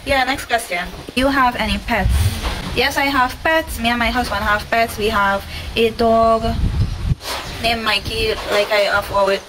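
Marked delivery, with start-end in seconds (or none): none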